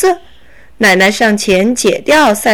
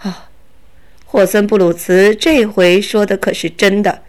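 plain reading voice

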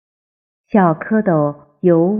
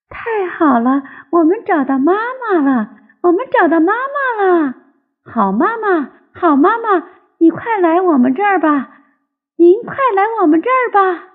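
put-on high, childlike cheering; overjoyed tadpoles; 10 semitones higher than usual. A narrator's reading. Tadpoles to their found mother, high excited cheering. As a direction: cry out joyfully in a small high voice. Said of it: "高兴地在水里翻着跟头".

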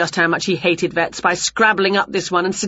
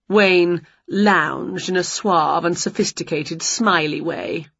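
plain reading voice

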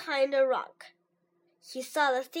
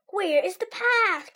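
higher, anxious call; crying out; 6 semitones higher, 4 dB louder than usual. A narrator's reading. Toad blinded by ice cream, calling out higher. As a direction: call out, lost and unable to see. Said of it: "cried Toad".

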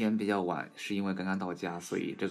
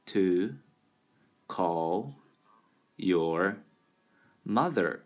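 slow word-by-word reading, clear pauses between words; slow demonstration; much slower than usual. Shown as a demonstration. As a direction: model the sentence slowly for learners. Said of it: "慢速的". (慢速逐词朗读)

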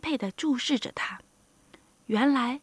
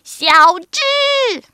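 high, very loud call of a name; shrieking old mother; piercing scream; 8 semitones higher, 13 dB louder than usual. A narrator's reading. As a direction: screech the name to stop a child dead. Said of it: "传来一声尖叫".

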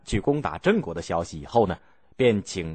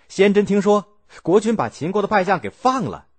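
higher, louder storyteller build-up; excited narration; 8 semitones higher, 4 dB louder than usual. A narrator's reading. Narrator tells of the general hearing the news, heightened. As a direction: raise the stakes as the news lands.

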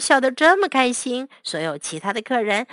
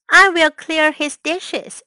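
usual speaking voice

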